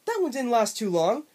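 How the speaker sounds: high, loud cartoon bobcat voice; obnoxious Bubsy voice. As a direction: ham up an obnoxious cartoon cat. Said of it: "had the most obnoxious voice I've ever heard".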